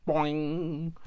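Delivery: boing. Pretend. Hair springs out suddenly.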